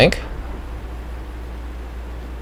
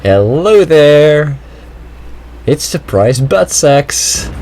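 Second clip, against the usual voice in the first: hammed-up greeting; much slower than usual. Commentator jokes about a Panther shot from behind, slow hammed-up greeting. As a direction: stretch each word out like a cheeky punchline.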